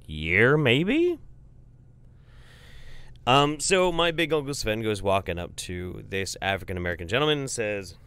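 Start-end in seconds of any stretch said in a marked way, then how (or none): none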